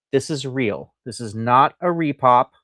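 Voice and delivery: normal talking voice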